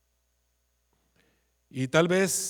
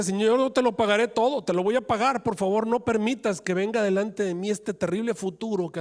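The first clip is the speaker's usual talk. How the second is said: higher, louder urgent begging; pleading servant; 6 semitones higher, 4 dB louder than usual. Acted. Servant begging the king to spare him, loud and high. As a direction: promise to pay everything, desperately.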